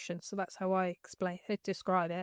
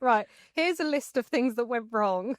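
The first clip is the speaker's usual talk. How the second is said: higher, louder mock inner voice; nagging brain voice; 4 semitones higher, 4 dB louder than usual. Coach voices the brain at four a.m., mock announcing. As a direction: play the brain kicking in, with a laugh midway. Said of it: "where my brain kicks in".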